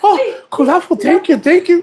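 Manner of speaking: high put-on grateful voice; mimicking grateful staff. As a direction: play the thankful worker, way up high.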